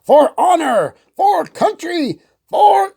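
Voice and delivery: rising high war cries; rally the troops; battle cry. Acted.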